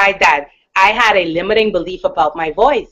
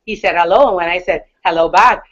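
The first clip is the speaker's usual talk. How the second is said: normal talking voice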